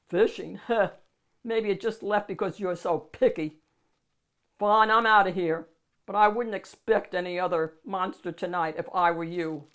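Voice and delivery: loud scoffing monster. Acted. Monster scoffs at the child and leaves, loud. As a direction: sneer and storm off.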